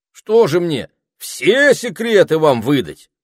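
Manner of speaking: high, loud rhetorical question; affronted, high-pitched complaint; indignant protest. A narrator's reading. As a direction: object in outrage, hammering the words. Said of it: "запротестовал Этельред".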